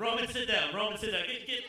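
loud calling out; yelling. Real, not acted. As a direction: holler at him to come. Ordering Roman to sit.

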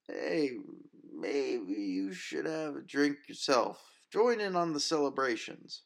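high, slow, boozy bar regular; drunk patron. Acted. Drunk patron cheering up bartender.

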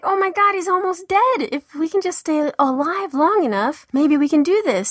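high, breathless retold excitement; excited gasp. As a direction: relive the moment he was nearly dead. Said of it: "I was like".

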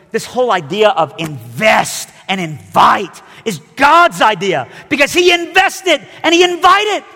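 loud, slow, rising preacher shout; full-force preaching. Real, not acted. Preacher urging the congregation, slow loud climax. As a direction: drive the point home at full force.

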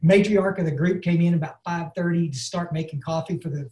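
normal talking voice

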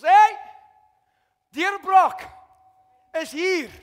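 loud excited call across the water; shouting to partners. Acted. Peter shouting to his partners about the catch, loud call. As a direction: yell it out to the other boat. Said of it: "He shouted to his partners".

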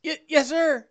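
high, stammered reply; obedient underling. Acted. Answering the player's order, high-voiced obedient underling. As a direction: squeak out a stammering yes.

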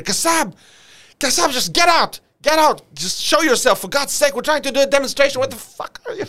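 high, loud sergeant yelling across a hillside; frustrated shouting, acted out; exasperated screaming. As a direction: holler for someone in hiding to come out. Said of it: "he kept screaming".

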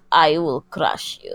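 deep, slow Russian menace; low and deliberate, mock Russian heavy; Russian accent. Host quoting Rocky's Russian boxer, deep Russian accent. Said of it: "Rocky. Where he's like".

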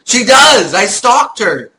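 loud, high playful protest. Real, not acted. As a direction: shout it in mock defense.

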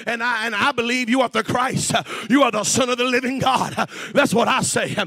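loud, driving preacher's cadence; full-force preaching. Real, not acted. Preacher telling listeners to use their own voice, full-force preaching. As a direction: build to a high shout on each big phrase.

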